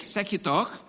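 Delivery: usual speaking voice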